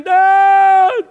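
imitated child's scream of dad. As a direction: yell for your father from downriver. Son screaming downstream.

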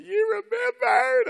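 very high, loud, drawn-out squeal; hammed-up girlish joy; beaming delight. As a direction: mimic a thrilled girlfriend in falsetto. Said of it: "she was beaming from head to toe".

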